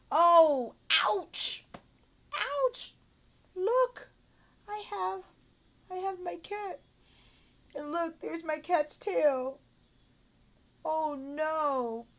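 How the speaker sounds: high, exaggerated ouch for a child; hammed-up sympathy. Shown as a demonstration. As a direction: act out the hurt for a little pupil. Demo acting out story with toy.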